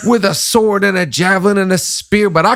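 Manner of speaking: high, emphatic impression of the actor; Denzel. Host imagines the actor reading David, declamatory impression. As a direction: declaim scripture as the imitated actor. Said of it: "What would he would have said?".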